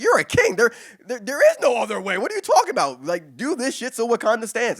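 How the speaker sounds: high, loud incredulous rant; exasperated, high-pitched yelling in a retold quarrel; outraged father. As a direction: berate your son at the top of your range. Father berating his son in retold comic.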